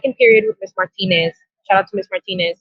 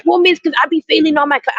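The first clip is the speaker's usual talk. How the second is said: higher, quicker imitated kid's excuse; lightly voiced student; 6 semitones higher than usual. Student explaining failing classes to the teacher, lightly imitated, high and hurried. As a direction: rush it out as a student, pitched up.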